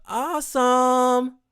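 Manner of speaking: long, high drawn-out awesome; excited. Real, not acted. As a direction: stretch it out with delight. Excited about first yoga.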